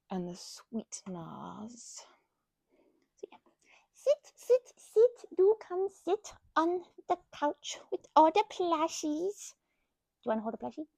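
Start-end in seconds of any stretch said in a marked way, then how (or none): 3.9-5.3 high, eager sit sit sit; excited invitation. Pretend. Inviting the viewer to the couch. 5.4-9.5 slow, coaxing and a touch higher; slow invitation. Pretend. Inviting the viewer to the couch.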